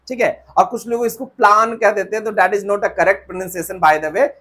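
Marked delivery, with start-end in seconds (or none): none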